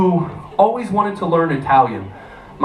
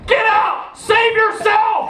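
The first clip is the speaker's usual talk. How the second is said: high-pitched yell from a burning house; hammed-up heroic scream; acted desperate shout; much slower than usual. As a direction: shout a frantic warning, last words drawn out. Trapped man in burning house.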